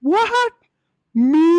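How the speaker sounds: very high, incredulous squeal; shocked disbelief. Acted. Peter to the servant woman who accuses him, shocked disbelief. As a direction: act startled at being accused, voice shooting up high. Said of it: "looked at the servant woman and said".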